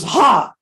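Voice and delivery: loud high victorious ha; triumphant ha. Retold or imitated. Prabhupada when his chapati puffs perfectly: loud triumphant ha. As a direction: crow it out in triumph.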